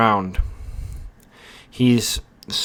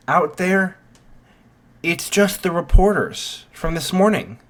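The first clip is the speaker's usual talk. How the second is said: higher-pitched, earnest proclamation; acted orator, pitched up and emphatic; addressing a crowd; 6 semitones higher than usual. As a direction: call the students to order in a put-on voice. Ida addressing panicked students.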